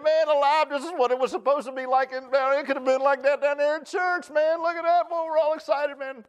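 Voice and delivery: high, loud acted jubilation; excited shouting. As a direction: shout it like you just got to heaven and can't believe it. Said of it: "shouting and saying".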